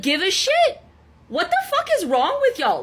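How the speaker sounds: high-pitched, indignant question; exasperated anger. Real, not acted. Scolding commenters who lack empathy, exasperated. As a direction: demand it in rising exasperation. Angry rebuke of accusing commenters.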